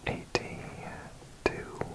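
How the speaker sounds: hushed ASMR whisper, drawn out slowly; soft whisper. Real, not acted. Introducing an ASMR video, slow soft whisper.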